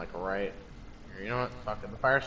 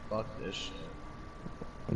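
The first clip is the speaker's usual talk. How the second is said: muttered; 3 semitones lower, 11 dB quieter, much slower than usual. Real, not acted. Giving up on the game.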